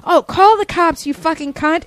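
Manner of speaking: high, loud mock taunt; yelling customer. Customer daring the clerk to call cops, mock yelling. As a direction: imitate a furious shopper daring the clerk. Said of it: "she started yelling at me".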